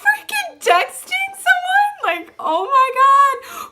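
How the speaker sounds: high, loud disbelieving shriek; incredulous outrage. Retold or imitated. Catching the girl texting during the fight, disbelieving. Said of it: "I'm like".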